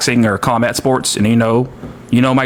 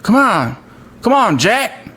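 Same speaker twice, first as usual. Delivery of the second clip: higher, swooping presidential impression; put-on elder statesman catchphrase voice; Joe Biden; 8 semitones higher than usual. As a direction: plead like an exasperated politician, pitch swinging up. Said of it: "Biden's always like".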